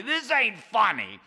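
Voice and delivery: put-on fed up old man; high codger groan; exasperated Charlie. Charlie annoyed.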